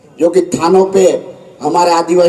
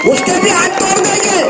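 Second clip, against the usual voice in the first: high shouted repeat; 10 semitones higher than usual. Real, not acted. Leader warns contractors at rally, high shouted threat.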